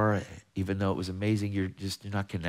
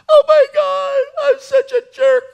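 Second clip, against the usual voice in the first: loud, very high mock sobbing wail; hysterical wail; 14 dB louder than usual. Sister-in-law confessing to the speaker, hysterical wail. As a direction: act out a loud tearful breakdown. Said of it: "She lost it".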